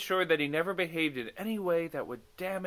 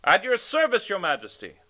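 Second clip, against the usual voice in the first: answering in unison; 7 semitones higher, 9 dB louder than usual. A narrator's reading.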